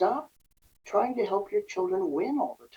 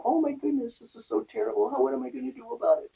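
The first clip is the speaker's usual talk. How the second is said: mock fretting; 5 semitones higher, faster than usual. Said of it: "every little setback or annoyance is".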